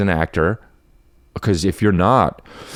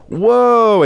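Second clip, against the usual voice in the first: mock whoa; 12 semitones higher, 5 dB louder than usual. Mocking a guy in a video.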